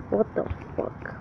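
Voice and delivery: breathy hushed whisper, no voice. Real, not acted. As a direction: drop to a secret whisper. Whispered aside while gaming.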